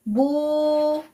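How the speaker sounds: बू with the ऊ stretched very long; बू dragged out slowly to show the mistake; drawn-out vowel. Shown as a demonstration. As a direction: hold the ऊ in बू, slow. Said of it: "लेकिन खींच दिया".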